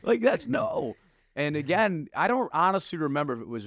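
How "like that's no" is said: laughing while talking. Real, not acted. Laughing at his own story.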